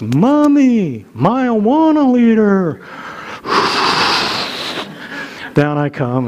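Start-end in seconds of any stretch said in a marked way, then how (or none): 0.0-2.8 put-on high, loud, slow excited kid; little child's voice. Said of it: "I hear this little voice". 2.9-5.5 long deflating sigh. Retold or imitated. Anger deflates after child's call.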